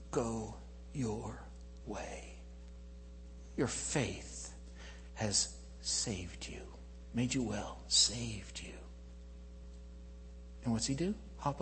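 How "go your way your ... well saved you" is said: very soft, slow, weighted pauses; hushed blessing. Acted. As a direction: breathe out the blessing almost in a whisper. Jesus gently heals the blind man.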